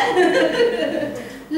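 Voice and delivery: a real burst of laughter, high; amused laugh. Real, not acted. Telling the audience about her father-in-law, bursting into laughter. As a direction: laugh out loud at the irony. Laughing at in-law's sales pitch.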